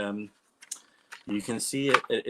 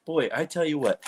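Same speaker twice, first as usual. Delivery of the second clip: ordinary talk, nothing marked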